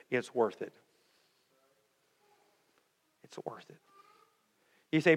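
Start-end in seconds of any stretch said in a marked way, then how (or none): 3.2-3.8 whispered, much softer, lower; a whispered echo of the line before. Shown as a demonstration. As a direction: breathe it out as a hushed whisper. Repeated line, second whispered.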